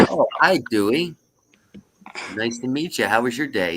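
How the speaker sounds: a lifted, mock-cheery greeting; friendly bartender. Bartender greeting the second duck in a joke, friendly.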